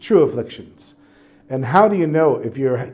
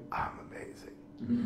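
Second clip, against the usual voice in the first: breathy whispered delight while sick in bed; whispering to his wife from the sickbed; whispered joy; 9 semitones higher, 19 dB quieter, slower than usual. Retold or imitated. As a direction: hush it out, pleased with yourself. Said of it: "I'm like".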